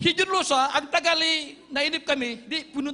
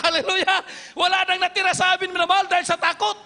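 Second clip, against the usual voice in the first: excited laugh; 6 semitones higher, 5 dB louder than usual. Real, not acted. Preacher laughing at the rescue joke.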